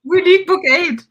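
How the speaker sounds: eager high cry for the next book; excited. Real, not acted. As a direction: beg loudly for the next book, thrilled.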